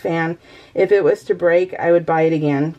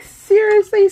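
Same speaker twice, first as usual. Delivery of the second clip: much higher, louder cry of disbelief; incredulous outburst; 13 semitones higher, 6 dB louder than usual. Retold or imitated. Retelling stubbing her toes on the vacuum, disbelieving outcry. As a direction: shriek it like you can't believe it. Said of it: "and I'm like".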